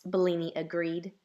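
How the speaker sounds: usual speaking voice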